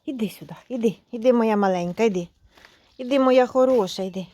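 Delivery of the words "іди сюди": soft whisper to the cat; whispered coaxing. Real, not acted. Coaxing the shy kitten over in a soft whisper. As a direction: whisper so as not to scare it.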